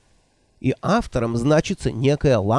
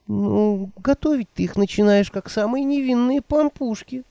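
put-on higher, fluttery old woman voice; 9 semitones higher than usual. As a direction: play the flustered old witch. Nanny voiced by narrator.

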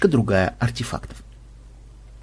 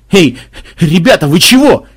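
alarmed; 4 semitones higher, 7 dB louder than usual. Acted. Captive panics.

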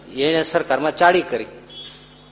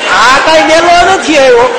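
exclaiming; 10 semitones higher, 10 dB louder, slower than usual. Retold or imitated. Punchline, slow exclaimed.

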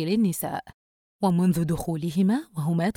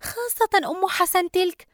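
high-pitched daughter, complaining; aggrieved younger daughter; 10 semitones higher than usual. Acted. Daughter complaining she was left with guests, aggrieved. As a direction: gripe to mother in a high voice.

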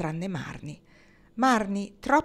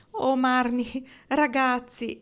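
raised, louder exclamation; higher-pitched, touched exclamation; exclaiming; 6 semitones higher, 5 dB louder, slower than usual. A narrator's reading. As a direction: exclaim it to your friends. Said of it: "esclamò Kendra".

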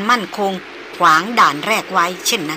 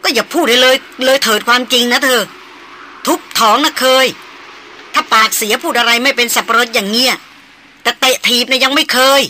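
raised, louder voice, telling him off hard; heated scolding; 4 semitones higher, 4 dB louder than usual. Acted. Darin rebuking Chaiyan for his careless mouth, loud and heated. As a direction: scold him like a fed-up woman in a quarrel.

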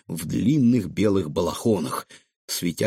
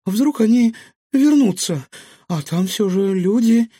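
high, loud, anxious worrying; nervous; 11 semitones higher, 6 dB louder than usual. A narrator's reading. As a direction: worry aloud in a raised, nervy voice. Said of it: "нервно предложила Илона". (нервно, с тревогой)

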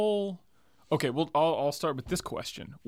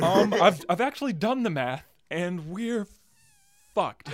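a lightly voiced nervous scientist; 7 semitones higher, 6 dB louder than usual. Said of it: "nervous scientist voice".

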